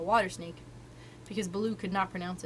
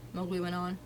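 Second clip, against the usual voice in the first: ordinary delivery, nothing marked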